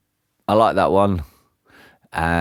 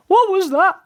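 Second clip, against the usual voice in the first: very high startled shriek; 4 dB louder than usual. A narrator's reading. Mister Jelly hearing a twig snap, shrieking. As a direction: squeal it in sudden terror. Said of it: "he shrieked".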